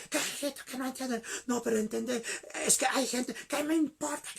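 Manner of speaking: high, irritated retort; acted quarrel. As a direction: imitate a pastor brushing off his wife. Pastor acted quarrelling with wife. (réplica airada y aguda, actuada)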